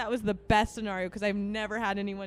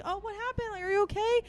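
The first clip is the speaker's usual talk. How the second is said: concerned cooing; 9 semitones higher, faster than usual. Retold or imitated. Finding her friend crying on the floor, imitated concern. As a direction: coo it high, like soothing someone upset.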